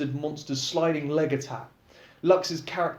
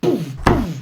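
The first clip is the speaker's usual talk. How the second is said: plain reading voice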